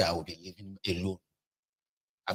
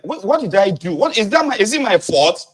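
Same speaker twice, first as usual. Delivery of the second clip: high, loud aggrieved protest; lightly voiced wife protesting her innocence; imitating Agbeke; 10 semitones higher, 6 dB louder than usual. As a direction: mimic the wife asking if it's her fault. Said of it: "Agbeke will be like".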